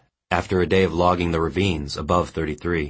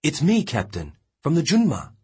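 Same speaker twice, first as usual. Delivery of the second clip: lightly voiced young man, higher; young sailor pleading; 11 semitones higher than usual. A narrator's reading. Young sailor gently calling to his dying captain. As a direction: reach out to the old captain.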